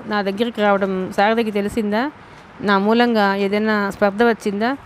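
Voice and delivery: plain reading voice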